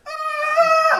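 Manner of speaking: short, very high shriek of pain; retold scream. Girl hit on the head by a falling drone, a high shriek. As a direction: shriek as if something just hit your head. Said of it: "Like, screaming after it hit".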